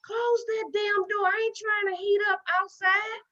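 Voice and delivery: mama's scolding. Retold or imitated. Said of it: "my mama saying".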